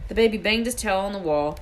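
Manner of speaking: ordinary delivery, nothing marked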